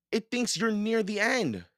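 high-pitched alarmed warning; urgent warning. A narrator's reading. Jack warns Annie of circling vulture, urgent. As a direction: warn her in a high voice.

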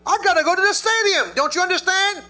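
high, loud exasperated plea; fed up with waiting, pleading out loud; impatient protest. Retold or imitated. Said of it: "I thought".

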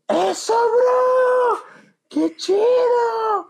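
high, slow drawn-out cheer; cheering crowd. Retold or imitated. Mexican neighbourhood cheering the gringo, high drawn-out shout. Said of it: "y todos".

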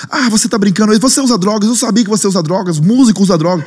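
high-pitched mimicry of outraged neighbours; desperate cursing. Desperate neighbours cursing the musician, outraged. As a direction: imitate a street heaping accusations on you. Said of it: "Todo mundo começou a me xingar".

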